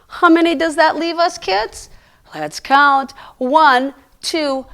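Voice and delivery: usual speaking voice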